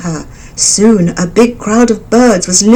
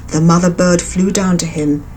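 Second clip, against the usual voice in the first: ordinary delivery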